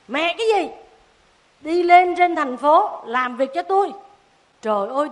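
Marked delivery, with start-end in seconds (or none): 0.1-3.9 loud, bossy order from the city woman; stern mother's scold. Acted. Harsh woman ordering Tí off to the city, loud and bossy. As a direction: bark it at the child like a harsh stranger.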